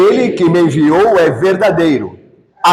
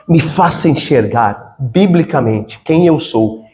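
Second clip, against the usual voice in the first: slow, lowered voice of a humble prayer; 8 semitones lower than usual. Shown as a demonstration. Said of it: "Peça ao Senhor".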